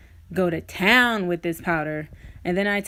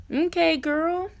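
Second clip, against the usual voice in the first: very high pitch; 9 semitones higher, slower than usual. Real, not acted. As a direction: hype yourself up in a squeaky voice. Hyping herself up.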